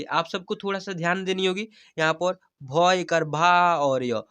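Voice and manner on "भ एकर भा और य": slow, deliberate syllable-by-syllable reading demo; slow spelling-out. Shown as a demonstration. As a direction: sound out भ, भा and य slowly, drawing out भा. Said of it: "ऐसे पढ़ने की सीखनी होगी ... धीरे-धीरे". (धीरे-धीरे हिज्जे करके पढ़ना)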